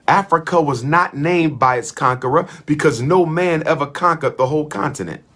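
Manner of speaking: usual speaking voice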